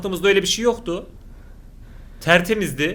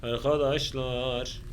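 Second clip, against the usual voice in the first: spooky hush; 6 semitones lower, 9 dB quieter, much slower than usual. Pretend. Spooky address to viewers.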